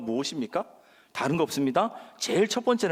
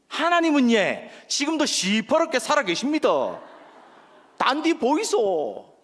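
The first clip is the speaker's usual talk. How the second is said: high, punchy Gyeongsang-accented proclamation; Gyeongsang dialect; 6 semitones higher, 4 dB louder, slower than usual. Shown as a demonstration. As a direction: belt it out in broad Gyeongsang dialect. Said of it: "이 경상도 버전이 있다라는 거예요".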